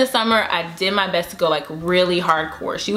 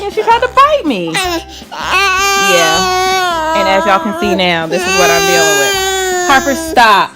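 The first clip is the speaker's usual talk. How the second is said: frazzled laugh; 10 semitones higher, 7 dB louder, slower than usual. Real, not acted. Mom to viewers over screaming toddler, loud frazzled laughing. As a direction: laugh through the chaos.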